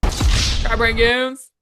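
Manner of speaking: slow emphatic correction. Real, not acted. Correcting friend's mispronunciation.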